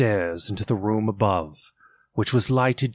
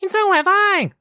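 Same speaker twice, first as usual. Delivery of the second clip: put-on very high, louder little child; child exclaiming; 5 dB louder than usual. A narrator's reading. Another child joins in, very high exclaiming. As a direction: pipe up like the smallest child. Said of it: "exclaimed another".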